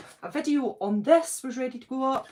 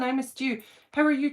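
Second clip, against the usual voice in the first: normal talking voice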